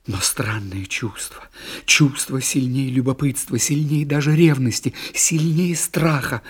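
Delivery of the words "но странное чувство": slow, hushed whisper; whispered, holding breath; tense whisper. A narrator's reading. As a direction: draw the listener in with a secret. Tense secret watching scene.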